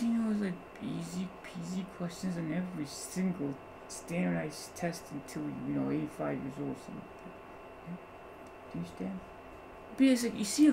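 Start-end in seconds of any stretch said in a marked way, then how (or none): 0.3-7.0 whisper. Real, not acted. Aside to students.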